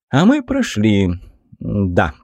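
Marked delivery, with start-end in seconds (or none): none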